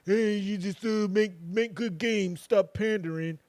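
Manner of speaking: put-on indignant gamer; mock-mad commenter. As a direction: imitate a griping fan. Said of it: "people getting mad".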